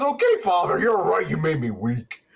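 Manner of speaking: mock obedient villain. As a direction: play a meek, defeated henchman with a fading voice. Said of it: "he goes".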